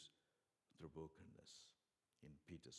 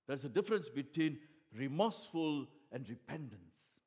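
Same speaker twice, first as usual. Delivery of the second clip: loud, high pulpit climax; loud impassioned sermon peak; full-force preaching; 6 semitones higher, 14 dB louder than usual. Acted. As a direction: drive the contrast home with fire. Preacher's climax on Peter's tears.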